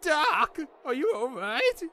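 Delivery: put-on high-pitched worried boy; alarmed concern. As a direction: call anxiously to a knocked-out friend. Boy horrified at unconscious mentor.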